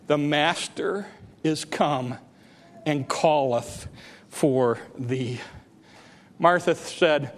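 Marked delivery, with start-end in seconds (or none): 0.1-5.4 slow, low and soft; hushed solemnity. Real, not acted. Preacher repeating the verse to the congregation, slow and hushed. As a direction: let each phrase fall slowly into quiet.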